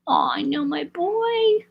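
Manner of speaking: high, slow doting coo. Real, not acted. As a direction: coo it to a beloved pet. Cooing at her dog.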